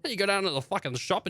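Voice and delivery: ordinary talk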